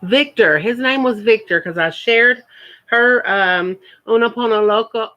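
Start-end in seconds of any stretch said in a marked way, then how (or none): 4.1-5.1 rushed through quickly; said fast. Shown as a demonstration. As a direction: rattle the name off fast. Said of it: "I can't say that too fast".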